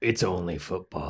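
low flat mock tough guy; deadpan low voice. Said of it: "And then I go".

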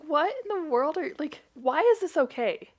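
high, stunned what-in-the; stunned disbelief. Retold or imitated. Staring at the gossiping receptionist, stunned. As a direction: gape in disbelief. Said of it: "But I was just like".

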